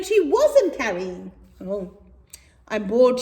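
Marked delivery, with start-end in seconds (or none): 1.6-3.2 a mild bored wail. Acted. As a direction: drag out the boredom. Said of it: "she would wail".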